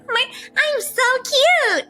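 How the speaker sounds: put-on high, slow cutesy anime girl. Shown as a demonstration. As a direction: coo it like a sweet anime girl. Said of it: "I can be like".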